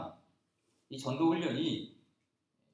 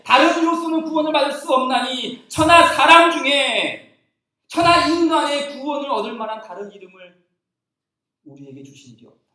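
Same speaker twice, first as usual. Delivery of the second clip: high, loud scripture reading; fervent Bible recitation; proclaiming scripture; 10 semitones higher, 14 dB louder than usual. Acted. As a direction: declare the verse to the congregation.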